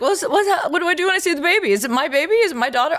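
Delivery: high, hurried mock panic; frazzled, high-pitched bewilderment; retold panic. Retold panicked questions to friends.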